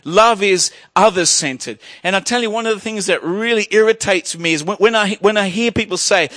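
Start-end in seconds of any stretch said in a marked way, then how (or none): none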